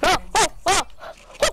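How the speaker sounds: high, loud cheering; excited. Real, not acted. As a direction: cheer the kill. In-game kill celebration.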